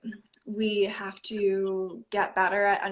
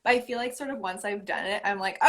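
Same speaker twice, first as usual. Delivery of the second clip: ordinary delivery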